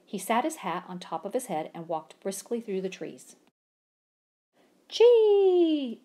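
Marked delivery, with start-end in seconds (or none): 4.9-6.0 high loud squirrel cry; a sharp high squirrel chatter. A narrator's reading. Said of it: "chattered a red squirrel".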